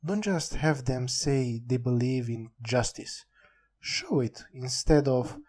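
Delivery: normal talking voice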